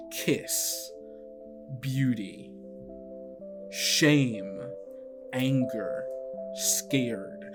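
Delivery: ordinary delivery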